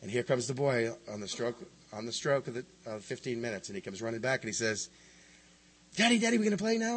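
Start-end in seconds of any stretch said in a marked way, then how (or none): 6.0-7.0 put-on high, eager little boy voice; five-year-old boy. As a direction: beg daddy to play like a small child. Boy returns asking to play.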